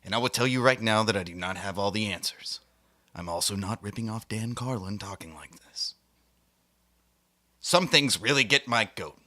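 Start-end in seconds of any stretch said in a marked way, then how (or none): none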